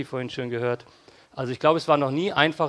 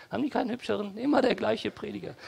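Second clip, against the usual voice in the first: snide complaint; 7 semitones higher, 4 dB quieter, faster than usual. Pretend. Critic mocks the church.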